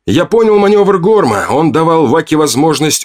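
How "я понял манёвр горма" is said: ordinary delivery, nothing marked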